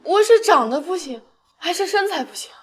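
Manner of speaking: loud tipsy pleading; drunken outburst. Acted. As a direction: yell it drunk and hurt. Drunk girl yells at prince.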